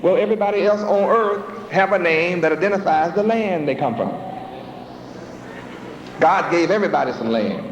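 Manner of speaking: ordinary talk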